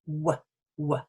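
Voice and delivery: "w" made with both lips coming together; isolated "w", lips rounded together; w sound demo. Shown as a demonstration. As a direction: demonstrate "w" as a short lip glide. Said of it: "that's when they come together".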